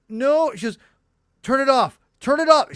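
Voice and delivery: put-on high, loud protest of an upset five-year-old; upset little daughter. Dad imitating his daughter begging to turn the episode off, loud and high. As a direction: plead high and loud to stop the show.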